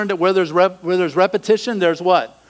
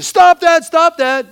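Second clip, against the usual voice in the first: much higher, much louder yell; mock scream; 13 semitones higher, 9 dB louder, slower than usual. Preacher shows what God does not do, a loud scream. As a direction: bark it like a furious scold. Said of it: "God doesn't scream at you".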